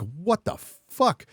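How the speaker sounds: high, slow, disbelieving exclamation; incredulous outburst. Retold or imitated. Seeing San Francisco's council turn communist, incredulous. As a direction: let the disbelief hang on every word.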